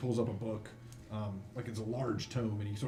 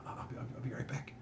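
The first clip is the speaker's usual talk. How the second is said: hushed, voiceless aside; soft, whispered boy's exit line; timid teenage helper; 8 dB quieter than usual. Acted. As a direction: stammer it under the breath. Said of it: "He says".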